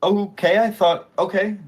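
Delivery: high, startled little "oh, okay"; woman thrown by a flat denial, high and surprised; taken aback. Acted. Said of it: "she'll be taken aback".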